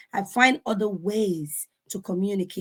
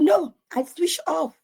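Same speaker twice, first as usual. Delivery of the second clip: daughter's voice; 7 semitones higher than usual. Retold or imitated. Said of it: "he said".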